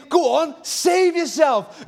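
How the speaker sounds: loud, high, slow taunting shout; mock crowd yelling at the cross; jeering taunt. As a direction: hurl it up at him as a jeer. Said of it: "They're saying".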